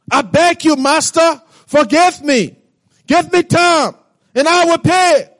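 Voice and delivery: a servant on his knees, pleading; begging servant. Acted. Servant begs king for mercy.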